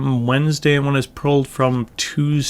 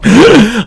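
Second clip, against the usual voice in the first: short laugh; 8 semitones higher, 7 dB louder than usual. Real, not acted. Laughs at own point.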